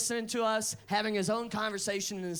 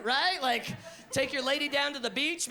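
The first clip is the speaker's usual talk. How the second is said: normal talking voice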